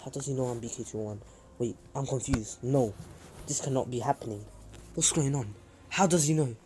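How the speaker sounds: hushed, confused inner monologue; inner thoughts. Acted. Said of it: "in his mind, is like".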